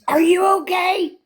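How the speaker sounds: put-on high, worried kid voice asking if she's okay; imitating a kid. Grandkid on the call checks on her, high kid voice. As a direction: pitch it up like a small concerned child. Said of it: "poor kid goes".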